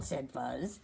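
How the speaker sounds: usual speaking voice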